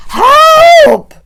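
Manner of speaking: very high, very loud scream for help; boy screaming for help in terror; terrified scream. A narrator's reading. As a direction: scream for your life. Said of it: "I began to scream".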